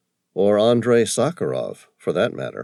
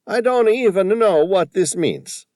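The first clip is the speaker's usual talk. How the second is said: high, loud, excited Tony voice with a put-on accent; an indignant shopkeeper squawking about the photo; riled old Tony; 13 semitones higher, 6 dB louder than usual. A narrator's reading. Shopkeeper upset at the vandal, loud high indignant voice.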